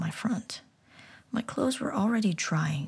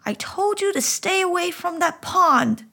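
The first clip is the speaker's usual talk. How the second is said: scolding; 12 semitones higher, 8 dB louder than usual. Acted. Nana scolding from the doorstep.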